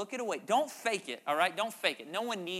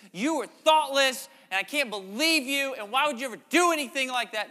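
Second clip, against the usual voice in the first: mock angry scolding; 7 semitones higher, 10 dB louder than usual. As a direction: scold like an offended friend. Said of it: "They could say".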